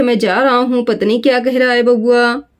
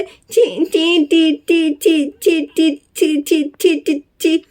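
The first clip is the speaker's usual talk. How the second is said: put-on baby babble; much slower than usual. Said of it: "बबुआ थोड़ी देर में रोने लगता है". (हल्की तोतली बच्चे की बड़बड़)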